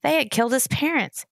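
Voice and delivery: ordinary delivery, nothing marked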